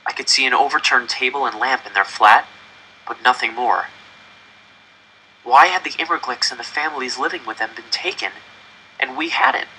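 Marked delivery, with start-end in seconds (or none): none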